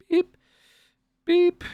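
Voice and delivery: high-pitched scanner beep; imitated checkout beep. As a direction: mimic a store scanner with a short high beep. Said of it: "It's just like, beep".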